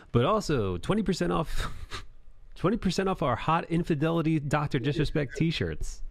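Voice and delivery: bright, high ad-read voice; upbeat sales pitch. Pretend. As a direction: switch to a chirpy sales plug mid-apology. Mock cheerful merch plug.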